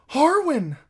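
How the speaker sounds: high, loud cry of the name; calling out. A narrator's reading. Girl calling to her father's man, high loud cry. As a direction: call out across the room. Girl calls to old friend.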